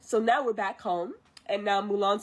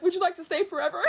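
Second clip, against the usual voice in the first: high, rushed, excited question; overeager grandma; 8 semitones higher, 3 dB louder, much faster than usual. Retold or imitated. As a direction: blurt it out like an eager grandma. Grandma's overeager invitation acted.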